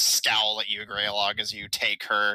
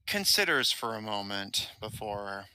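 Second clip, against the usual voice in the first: ordinary delivery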